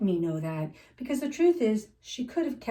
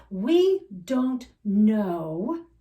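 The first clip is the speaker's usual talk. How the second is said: very slow, louder weighted words; very slow, louder emphatic delivery; emphatic slow stress; 6 dB louder, much slower than usual. Real, not acted. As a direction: lean on each word to land the point. Sermon point, drawn out.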